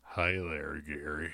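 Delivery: put-on low, drawling old-timer cowboy. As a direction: speak like a grizzled range cowboy. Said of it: "Whoa, he's Cowboy Will".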